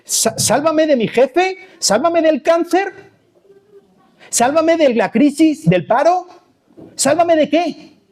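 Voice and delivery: high, questioning plea; mock pleading. Preacher acting out people's prayers for rescue, mock pleading. Voicing people's petitions to be saved.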